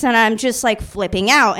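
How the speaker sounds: usual speaking voice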